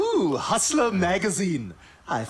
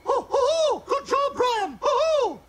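whooping cheers; slower than usual. Acted. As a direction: cheer him on like a rowdy fan. Cheering Brian on again.

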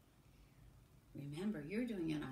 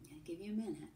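ordinary delivery

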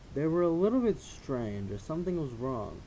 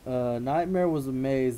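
usual speaking voice

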